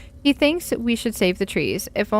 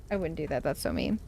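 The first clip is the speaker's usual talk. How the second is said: soft aside; 6 semitones lower, 10 dB quieter, faster than usual. Real, not acted. Softly reassuring the cat.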